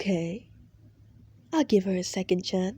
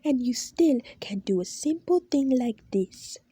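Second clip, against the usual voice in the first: higher, louder furious rant; shouting boss; 4 semitones higher, 4 dB louder than usual. Acted. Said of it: "he says as he starts to shout".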